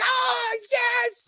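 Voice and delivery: loud, very high cheer; triumphant high-pitched scream of delight; ecstatic yell. Retold or imitated. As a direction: re-enact freaking out with joy, yelling it. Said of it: "I was, like, yelling out".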